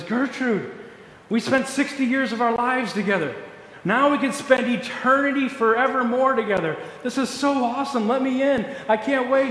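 a husband thrilled to be reunited; husband pleading at heaven's gate, eager and excited. Acted. Eager husband arrives at gates.

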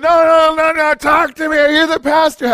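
loud, high mock drunk voice; drunk man calling out. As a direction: call out like a tipsy stranger.

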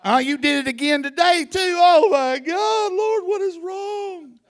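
acted sinner sobbing out loud; crying shout. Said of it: "they're weeping and they're crying".

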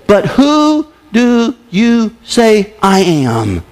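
slow, every word stretched; slow emphatic question. Shown as a demonstration. Preacher reading Jesus' question to the church, slow. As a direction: ask it slowly and pointedly. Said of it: "circle the next seven words".

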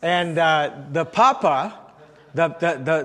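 ordinary talk, nothing marked

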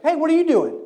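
a loud stranger barging in; boisterous greeting. Retold or imitated. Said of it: "kind of a boisterous guy".

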